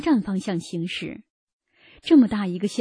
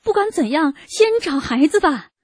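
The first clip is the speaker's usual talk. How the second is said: higher-pitched, mildly anxious appeal; anxious urging; 6 semitones higher, 4 dB louder than usual. A narrator's reading. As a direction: urge everyone on, worried but contained. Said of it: "校长急得对大家说". (略带焦急地催促)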